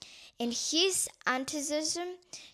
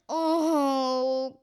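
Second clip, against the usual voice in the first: a loud, long wail of dismay; loud cry; 8 dB louder than usual. A narrator's reading. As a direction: cry it out at full voice. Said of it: "cried Supra at the top of her voice".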